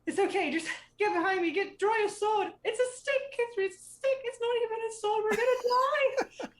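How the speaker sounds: put-on high, loud kid voice, panicking; a frantic little girl bossing her sister; panicky child. Child urging her scared sister to hide, panicking. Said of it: "The other one says".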